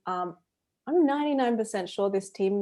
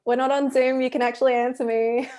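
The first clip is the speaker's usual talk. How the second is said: imitated teacher, raised and louder; teacher prodding class; 5 semitones higher, 6 dB louder than usual. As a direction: playfully prod an unresponsive class as their teacher. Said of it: "really long pause and being like".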